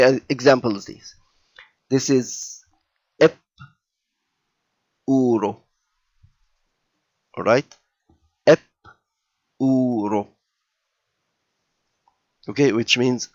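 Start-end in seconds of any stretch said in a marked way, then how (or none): 3.2-5.6 "ep uro" with "ep" set apart by a long pause; separate syllables. Shown as a demonstration. Teacher showing how the jenkem splits off a syllable, "ep" apart from "uro". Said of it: "as if there is an A before it". 8.5-10.3 "ep" on its own, pause, then "uro"; separate syllables. Shown as a demonstration. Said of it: "as if there is an A before it".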